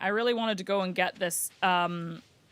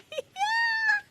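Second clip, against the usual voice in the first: very high squealing laugh. Real, not acted. After her own silly impression, squealing laugh. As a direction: crack up in a squeal. Cracks up after Jar Jar attempt.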